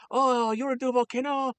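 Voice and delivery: very high, eager mock Japanese chef; heavy Japanese accent. Hibachi chef entertaining officers at lunch, heavy mock Japanese accent. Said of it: "and the guy's like".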